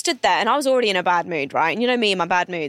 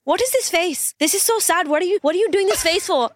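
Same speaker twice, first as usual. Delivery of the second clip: high mock-scolding Indian accent; 9 semitones higher than usual. Woman criticising her face, high-pitched Indian accent. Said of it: "I can put on this accent".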